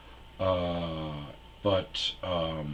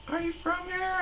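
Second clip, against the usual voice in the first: put-on high girly voice. Comic voicing the concert girl, very high falsetto. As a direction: shriek it like a bubbly girl.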